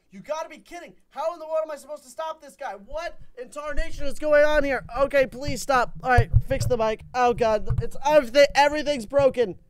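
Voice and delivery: high, loud frantic complaining; flustered, high-pitched outburst; exasperated panic. Real, not acted. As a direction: cry out as everything goes wrong at once. Game and mic going wrong.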